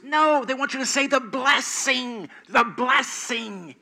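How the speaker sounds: high, loud voice urging her; husband explaining. Retold or imitated. Husband urges Aunt Bethany to say the blessing, high and insistent. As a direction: lean over and press the point, slowing at the end. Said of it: "her husband leans over and says".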